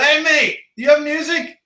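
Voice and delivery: high-pitched acted hail; acted call-out. Calling to the skydiving guide.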